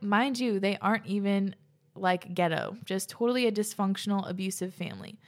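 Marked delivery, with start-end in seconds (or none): none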